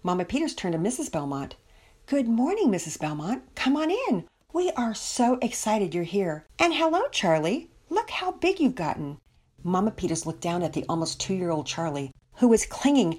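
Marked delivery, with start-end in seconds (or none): 2.1-9.2 high, sing-song welcome with lifted peaks; gushing hostess. Acted. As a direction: greet guests and a toddler with delighted excitement. Mama Peters greets visitors.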